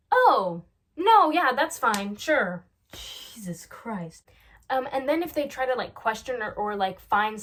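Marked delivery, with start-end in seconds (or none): 0.1-2.6 loud, put-on affronted acceptance; mock scandalized. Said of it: "pretend to be scandalized". 2.9-4.3 slow, hushed whisper; mock scandalized. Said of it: "pretend to be scandalized".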